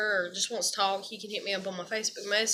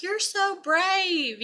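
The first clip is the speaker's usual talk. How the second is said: high, drawn-out imitation of pity; mock gushing sympathy; 8 semitones higher, much slower than usual. Imitating online sympathizers praising her bravery, mock gushing. Mimics people pitying her.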